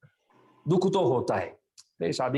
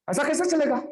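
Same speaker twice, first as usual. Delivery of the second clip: "ऐसा कैसे चलेगा" asked much higher, as a stunned cry; loud astonished question; 11 semitones higher than usual. Retold or imitated. God, stunned by the soul's reply, cries out much higher. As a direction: push the repeat up into disbelief. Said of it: "भगवान जोर से पूछते".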